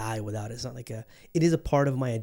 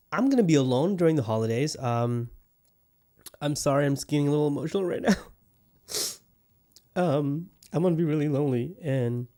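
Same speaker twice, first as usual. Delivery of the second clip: mock tearful, hurried confession; a hammed-up touch of self-pity; fake emotional. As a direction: pretend to choke up, then rush on. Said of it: "just kidding".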